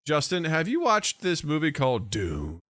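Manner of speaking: ordinary talk, nothing marked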